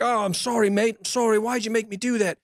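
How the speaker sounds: high, pleading voice of a remorseful killer; imitating near-tearful apology. Killer begging his dying victim's forgiveness, mock near-tears. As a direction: mimic someone begging forgiveness, almost crying. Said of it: "he's almost crying. And he's like".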